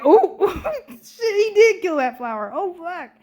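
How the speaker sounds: high laughing squeal of disbelief; laughing in high shocked disbelief. Retold or imitated. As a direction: cackle through a shocked swear. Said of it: "And I was like".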